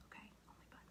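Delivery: a sudden hushed whisper. Shown as a demonstration. Whispering the advice to her viewers. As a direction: whisper the words like a secret aside. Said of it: "Only buy the book if you really want".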